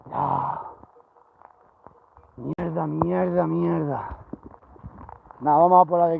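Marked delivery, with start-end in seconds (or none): 0.1-4.2 slow, soft muttered curses; cursing under breath. Real, not acted. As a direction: swear quietly to yourself, defeated. Frustrated by animals.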